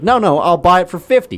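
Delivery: high, loud insistent haggling; mock haggling rant. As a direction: push back hard on the price. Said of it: "it's like".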